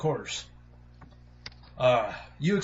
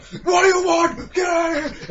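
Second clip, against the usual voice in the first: high, loud furious yell; angry shout; 6 dB louder than usual. Acted. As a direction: chase the kids off the porch. Scaring kids at the door.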